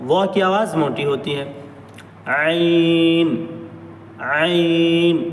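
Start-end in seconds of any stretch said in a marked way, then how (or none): 2.3-5.3 عین held long from the middle of the throat; drawn-out ain demo. Shown as a demonstration. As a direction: stretch عین slowly for the class. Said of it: "بیچ والے حلق سے".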